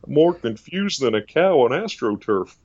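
slow folksy Texan drawl; Doctor Phil Texan saying. Retold or imitated. Doctor Phil delivers a Texan saying, slow. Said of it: "Texan sayings".

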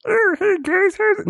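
high, bright jokey god voice; silly echoing voice. Acted. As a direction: pitch it way up as a silly cosmic greeter. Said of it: "No, I'm just joking".

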